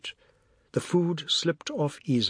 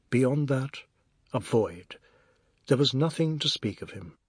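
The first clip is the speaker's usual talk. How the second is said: usual speaking voice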